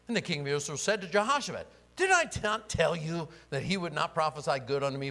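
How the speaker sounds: loud, high indignant reading; complaining king, dramatic reading. Acted. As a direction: blurt the king's grievance loudly. Reads king's complaint aloud.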